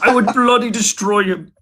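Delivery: high-pitched impression voice; Jordan Peterson. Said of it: "I'll do a Jordan Peterson".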